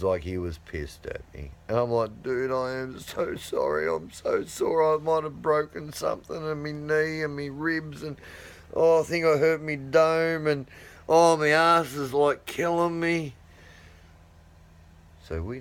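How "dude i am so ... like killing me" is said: milk the pain a bit, apologising while sore; pained whiny apology. Retold or imitated. Retold pained apology to sulking son.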